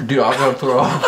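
laughing while gagging; laughing nausea. Real, not acted. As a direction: gag and laugh together. Eating the puke fruit.

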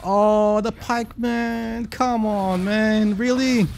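high, drawn-out complaint; exasperated whine. Real, not acted. Player complains about a pikeman in game, exasperated whine.

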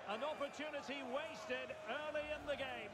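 high-pitched cry over a missed chance; excited, high-pitched play-by-play outburst; excited commentary. Real, not acted. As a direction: react live to a near miss, pitched well up.

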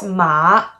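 MA dipping down, then rising; third tone. Shown as a demonstration. Teacher introducing the third tone, dipping. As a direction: scoop MA down and up. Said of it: "it goes down and up".

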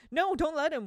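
loud, high desperate cry; a boy begging them to stop; Will's frantic shout. Acted. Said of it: "Will cried".

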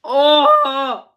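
sudden loud high yelp; shocked outburst. Real, not acted. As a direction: blurt it out, startled by sourness. Sour candy hits hard.

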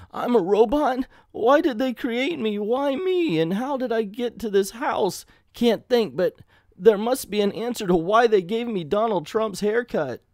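high-pitched, bewildered hero; hammed-up identity crisis, pitched well up; distraught robot. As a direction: plead for answers in mock anguish. Acts robot shocked to learn the truth.

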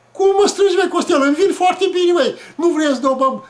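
high, loud protest to a brother; acted childhood protest; indignant protest. Protests brother rejecting the boots.

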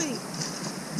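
whispered. Real, not acted. Coaching a nervous jumper.

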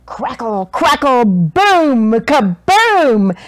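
loud crackling booms; loud thunder. A narrator's reading. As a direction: crash it out like a storm. Thunder sound words.

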